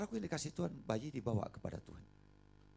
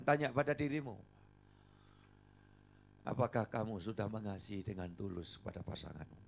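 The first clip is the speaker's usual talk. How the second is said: ordinary delivery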